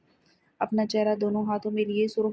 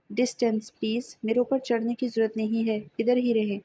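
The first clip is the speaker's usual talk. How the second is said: ordinary delivery, nothing marked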